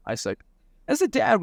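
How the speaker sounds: usual speaking voice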